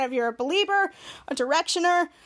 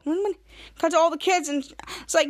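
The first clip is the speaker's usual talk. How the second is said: ordinary talk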